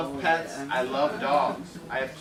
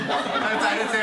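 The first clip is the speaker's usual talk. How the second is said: usual speaking voice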